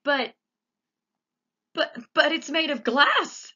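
high, loud stammering; sputtering alarm; frightened shout; panicked shout. A narrator's reading. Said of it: "shouted Grandpa Joe".

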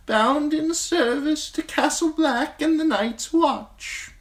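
an ancient maester teaching a hard lesson; old maester voice. Acted. Old maester lectures Jon on the Night's Watch vows, high and grave. Aemon reveals his lineage.

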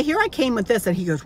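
usual speaking voice

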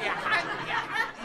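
high teasing sing-song "يا حاج"; mocking hajj call. Ramzi teasing Dola about the hajj, high mocking call. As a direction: needle him with a high "يا حاج".

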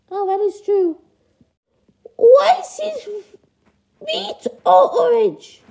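high falsetto, conceding then questioning; high-pitched puppet parent; duckling's parent, puzzled. Acted. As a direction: give in, then query in a squeaky voice.

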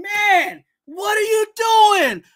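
high, loud, stretched-out question; exasperated shout. Acted. Host scolding the coach over his linebacker choice, exasperated shout. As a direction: shout it slowly and high in disbelief. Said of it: "Who was you yelling at".

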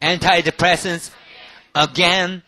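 loud, very slow "Antidepressants"; slow drill. Shown as a demonstration. Teacher drilling a hard drug name for students. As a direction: sound out each syllable of "Antidepressants". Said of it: "teacher drills the clip line".

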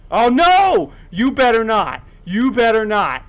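high, loud panicked pleading; alarmed warning. Real, not acted. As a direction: shout a desperate warning at the threat. Alarmed warning to game threat.

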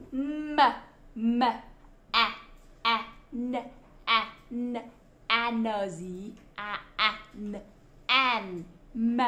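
each sound said apart, "m", "a", "n"; sounding out phonics. Shown as a demonstration. Teacher spelling man with children, "m" and "an" sounded separately. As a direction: blend "a" and "n" into "an", piece by piece. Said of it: "đang học cách đánh vần".

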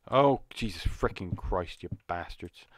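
usual speaking voice

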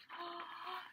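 soft gasp of wonder; amazed gasp. Acted. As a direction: gasp in awe. Seed amazed at success.

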